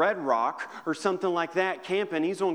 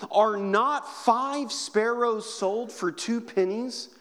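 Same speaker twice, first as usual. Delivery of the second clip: higher, slow, fervent scripture; scripture reading; 5 semitones higher, 3 dB louder, slower than usual. Acted. Preacher proclaiming Jesus's words to church, higher and slow. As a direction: proclaim each phrase slowly.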